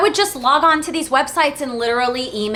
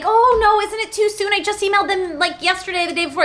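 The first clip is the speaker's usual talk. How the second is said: high-pitched fretting to a parent; worried protest; 10 semitones higher than usual. Retold or imitated. Daughter protesting to dad that it's too soon, high worried voice. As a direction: re-enact anxious hesitation, pitched way up.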